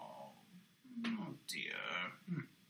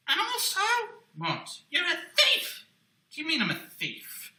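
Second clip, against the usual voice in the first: ordinary talk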